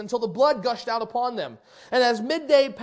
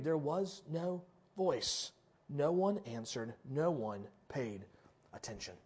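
slow, quiet, falling refrain; slow solemn refrain; 9 semitones lower, 13 dB quieter than usual. Acted. Preacher dropping to a hush on silence of Baal. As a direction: let each line land heavy and soft.

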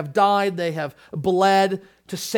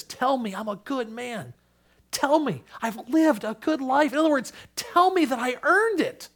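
pleading, higher, near tears; weeping plea; 8 semitones higher than usual. Retold or imitated. Old Ryan to his elderly wife, mild tearful plea. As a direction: beg for reassurance, rising. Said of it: "he begins to weep".

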